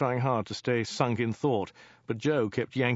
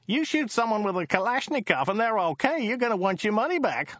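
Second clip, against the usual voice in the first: high-pitched incredulous driver; high, indignant driver; Joe the driver, scornful; 10 semitones higher than usual. Acted. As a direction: scoff in a high character voice.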